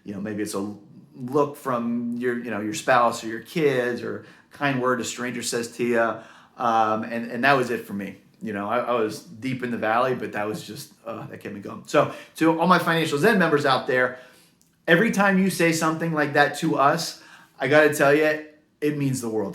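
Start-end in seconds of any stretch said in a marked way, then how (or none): none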